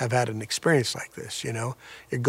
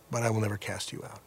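soft, fast, hushed promise from Jesus; a soft, quick comforting vow; tender reassurance; 4 semitones lower, 8 dB quieter, faster than usual. Acted. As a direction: whisper the promise gently to a frightened sinner.

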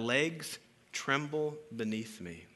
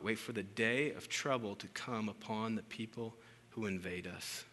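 ordinary delivery

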